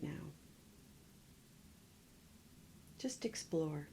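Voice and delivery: calm body-scan guiding with long pauses; guided meditation. Real, not acted. Meditation teacher leading listeners through a full body scan, calm guiding voice. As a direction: guide them gently inward, leaving long silences between phrases.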